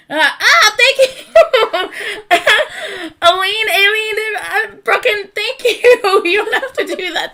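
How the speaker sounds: high, loud gushing through laughter; excited gratitude. Real, not acted. Streamer thanking viewers by name, excited gratitude.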